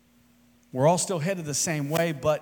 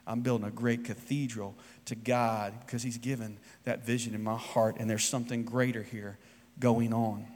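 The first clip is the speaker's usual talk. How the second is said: softer, low, reverent retelling; quiet conviction; 4 semitones lower, 7 dB quieter than usual. Acted. Third bricklayer explaining his vision, soft and reverent. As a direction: say it quietly, like a treasured secret. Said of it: "And the other said".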